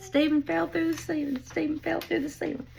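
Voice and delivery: a hint of a child blurting out news; imitated excited kid. Stepson telling daddy about the fall, lightly imitated excited kid.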